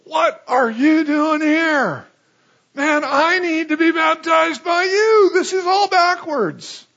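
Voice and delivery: high, loud shout of disbelief; astonished John. Acted. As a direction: cry out as John, stunned and protesting. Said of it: "John, his cousin, says".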